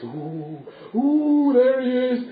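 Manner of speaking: hammed-up spooky ooh, building; spooked butler. Butler spots the skeleton, rising mock-spooked voice.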